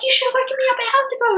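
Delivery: high, loud, rapid scolding; mock panicked reproach. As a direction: rush it out like a frantic partner. Acting panicked partner's reproach.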